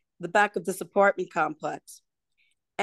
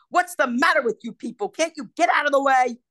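mild mock yell, a bit louder; imitating a rude passer-by's yell; retold yell; 5 semitones higher, 5 dB louder than usual. Husband snapping at blocking group, mild mock yell. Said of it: "the husband yelled at us and said".

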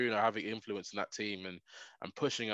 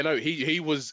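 ordinary talk, nothing marked

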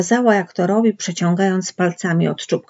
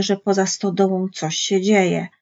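ordinary delivery

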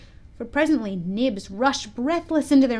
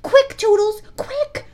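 very high, urgent fairy cry; Tinker Bell; 12 semitones higher, 7 dB louder, slower than usual. A narrator's reading. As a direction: urge on the archer shrilly. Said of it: "she cried".

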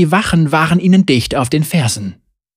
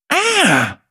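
frustrated cry; 12 semitones higher than usual. Acted. Zeri strikes the grate in frustration.

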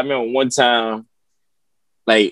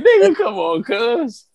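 pitched-up come on; high exasperated plea; 10 semitones higher than usual. Retold or imitated. He begs the dad to stop, high and exasperated. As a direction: whine it high. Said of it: "It's like".

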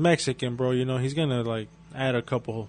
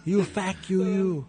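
mock angry cousin; 7 semitones higher, slower than usual. Friend imitating the cousin.

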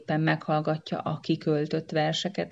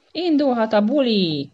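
high, loud shout to kick off the party; 7 semitones higher, 7 dB louder than usual. Acted. Monkey chief opening the party, loud shout. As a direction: yell it to get things going. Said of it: "kiabálta teli szájjal".

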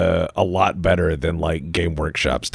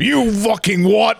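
slow, high, menacing mock challenge; mock pub brawler; 4 dB louder than usual. To the film fan in the pub, slow mock pub threat. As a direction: drag out the threat like a pub hard man.

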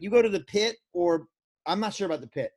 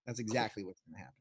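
low, quiet sarcastic aside; mock realization, lower and softer; deadpan sarcasm; 8 semitones lower, 13 dB quieter, faster than usual. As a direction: undercut yourself drily. Sarcastic correction after "oh no, wait.

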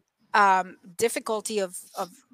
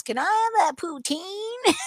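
put-on Texas accent; 9 semitones higher than usual. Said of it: "So he goes".

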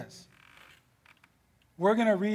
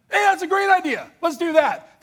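much higher, louder sarcastic cheer; mock eager king; 9 semitones higher, 5 dB louder than usual. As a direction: cheer like an empty-headed king. Mocking the gullible king.

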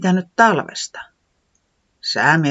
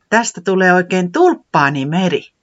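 louder delighted exclamation; picture the tulip sea; 4 semitones higher, 6 dB louder than usual. Acted. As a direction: Tiputi delighted about the flowerbed. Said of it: "Tiputi sanoi ihastuneena".

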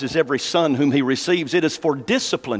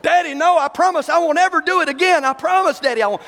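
put-on pleading child; 9 semitones higher than usual. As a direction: beg Daddy like a panicked kid. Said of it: "I'd go crazy".

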